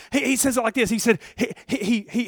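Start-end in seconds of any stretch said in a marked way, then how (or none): none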